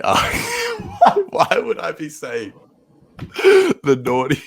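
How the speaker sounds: high, loud, laughing incredulously; helpless laughter. Real, not acted. Co-host cracking up over the naughty mix-up, helpless laughter. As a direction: squeal the words through real laughter.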